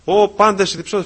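high, loud cry to everyone; calling out loudly. Shown as a demonstration. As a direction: call it out as God's summons. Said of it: "φωνάζει ο Θεός". (δυνατή, υψηλή φωνή καλέσματος)

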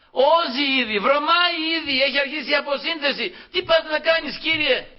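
high, agitated objection; acted dismay in a high preaching voice; alarmed protest. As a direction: protest urgently, pitched well up. Acted protest of Lazarus's sisters.

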